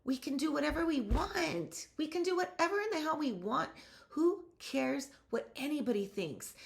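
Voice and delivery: high-pitched, fired-up pep talk; rally them with rising passion; defiant rallying. Real, not acted.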